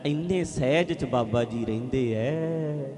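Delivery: slow, low, soft imitation; hushed, dragging mock serenity; mock serene devotee. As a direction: drawl it reverently like a besotted follower. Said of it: "ਹੌਲੀ ਹੌਲੀ ਬੋਲਦੇ ਆ".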